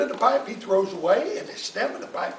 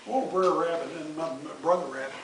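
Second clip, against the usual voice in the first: plain reading voice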